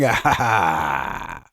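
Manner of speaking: slow hammed-up ha ha ha; drunken guffaw. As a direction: laugh it out slow and wild. Wild orgy begins.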